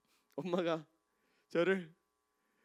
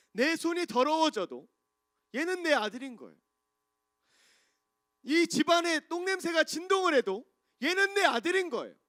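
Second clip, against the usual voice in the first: full-force preaching; 11 semitones higher, 10 dB louder than usual. Acted. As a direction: proclaim it like a fervent preacher.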